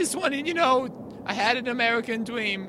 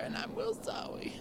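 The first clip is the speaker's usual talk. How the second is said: hushed, voiceless sorry; breathy whispered regret; whispered apology; 9 semitones higher, 13 dB quieter than usual. Acted. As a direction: whisper it like a guilty kid. Hurricane apologizes, choked up.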